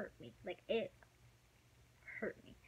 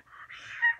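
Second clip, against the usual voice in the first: high, loud startled scream; shocked scream; 12 dB louder than usual. Retold or imitated. Seeing the ex across the street, a shocked scream. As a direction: scream in surprise at the sight. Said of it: "I was screaming".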